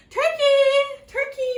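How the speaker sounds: high, loud sweet sing-song calling; a moose calling sweetly to lure the turkey; sweetest call. A narrator's reading. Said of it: "called in his sweetest voice".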